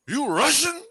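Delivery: put-on high-pitched jokey Russian accent; mock Russian broken English. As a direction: ask in goofy pidgin Russian English. Goofing in broken English to foreigner.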